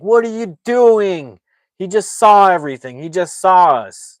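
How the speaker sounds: loud, high, exasperated mock rebuke; imitated alarmed scolding. Colorado berates his partner before a witness, imitated loud scolding.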